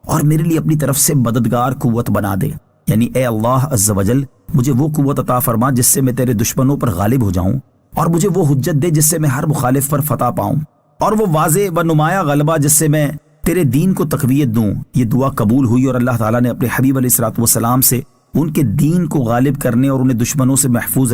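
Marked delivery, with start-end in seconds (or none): none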